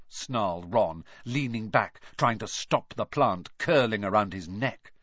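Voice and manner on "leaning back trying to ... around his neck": ordinary delivery, nothing marked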